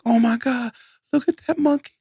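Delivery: very high cooing voice. Retold or imitated. Little old lady cooing at a monkey in a suit, very high. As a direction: coo over the monkey way up high.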